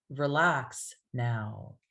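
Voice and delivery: very slow "Relax now", tone sinking on "now"; downward command. Shown as a demonstration. Hypnotist commanding a client to relax, downward tone. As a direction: stretch "Relax", then let "now" fall gently. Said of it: "see how the tone goes down".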